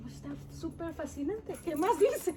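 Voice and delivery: más aguda y fuerte al final; excited retelling. Retold or imitated. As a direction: repite su entusiasmo por el diario. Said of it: "y yo". (emocionada, pidiendo más del diario)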